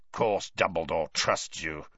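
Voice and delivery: low growled retort; a low menacing growl aimed at Snape. A narrator's reading. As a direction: growl it like a gruff old auror. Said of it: "growled Moody".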